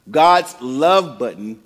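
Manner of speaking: God's love button hit slow and loud; slow emphatic punch. Acted. Preacher to congregation, slow loud punch. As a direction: lean into it for the congregation.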